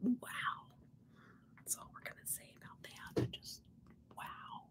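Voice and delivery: hushed, speechless amazement; awed whisper. Real, not acted. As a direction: whisper it as if lost for words. Awed at the makeup result.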